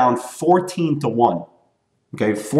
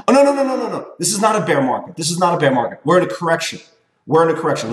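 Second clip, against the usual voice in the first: high, hammed-up denial; mock panicked denier; 5 semitones higher, faster than usual. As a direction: parody someone refusing the truth. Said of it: "Say the word bear market".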